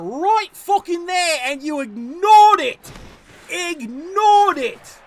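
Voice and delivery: loud slow disgusted yell; disgusted shout. Real, not acted. Gamer berating the team for ignoring the win. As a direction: drag it out, outraged. Scolding the AI coach.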